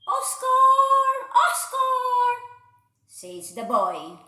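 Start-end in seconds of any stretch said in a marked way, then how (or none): 0.1-2.4 high sing-song call, loud; boy calling his dog across the snow, high and singing. Acted.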